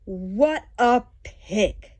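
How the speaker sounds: usual speaking voice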